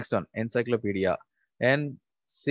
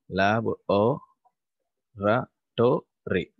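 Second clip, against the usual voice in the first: ratory drawn out as its own chunk; teacher splitting laboratory for the class; slow syllable split; slower than usual. Shown as a demonstration. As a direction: say ratory slowly, apart from lab. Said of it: "divide into lab or ratory".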